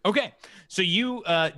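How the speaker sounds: normal talking voice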